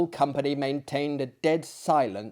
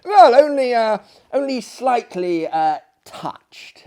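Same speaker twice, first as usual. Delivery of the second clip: awkward gentleman; 9 semitones higher, 11 dB louder than usual. Acted. Narrator character, awkward hint.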